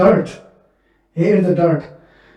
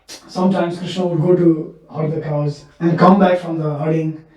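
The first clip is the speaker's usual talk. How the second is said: usual speaking voice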